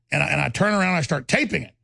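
ordinary talk, nothing marked

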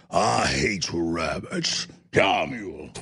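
very low, slow cartoon cowboy; exaggerated Texas accent, deep and dragged out; Yosemite Sam. Host showing off his impression, deep slow Texan cartoon voice. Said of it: "I can do Yosemite Sam".

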